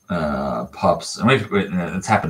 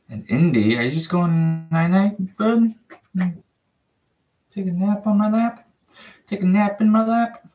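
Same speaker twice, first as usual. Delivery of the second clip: puppy talk; 4 dB louder than usual. Real, not acted. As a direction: lull the pup up high and sweet. Coaxing puppy to nap.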